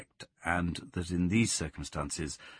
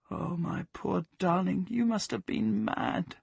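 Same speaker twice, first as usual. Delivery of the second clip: tender pity; 10 semitones higher than usual. Acted. Plaxi pitying her dog while stroking his throat. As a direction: pity him slowly and gently.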